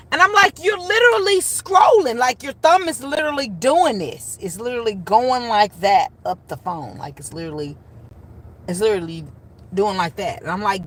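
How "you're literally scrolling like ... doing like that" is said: high, loud incredulous protest, easing toward the end; exasperated aunt. Retold or imitated. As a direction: act out losing patience with a niece, starting at full pitch. Retold exasperated argument with niece.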